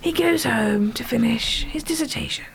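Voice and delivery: slow, sinking nature narrator; measured documentary voice, softening at the end; David Attenborough. Acted. Co-host finishing the mock wildlife narration, slow hushed impression. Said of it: "David Attenborough".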